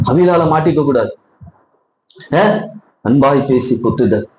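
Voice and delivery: normal talking voice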